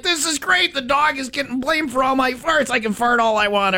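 high, gleeful inner voice; lightly voiced smug boyfriend. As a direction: play the sneaky guy delighted with his luck. Guy's gleeful inner thoughts.

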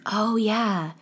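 normal talking voice

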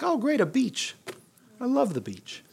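sarcastic cheer. Pretend. Trapped Israelites mocking their situation, high sarcastic cheer. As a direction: fake enthusiasm about a beach. Sarcasm facing the Red Sea.

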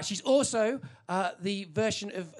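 ordinary talk

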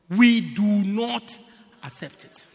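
slow, hammered "We do not", then dropped "accept it"; deliberate, drawn-out insistence; emphatic refusal. Acted. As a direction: spell out the refusal word by word. Pastor insisting rule not accepted.

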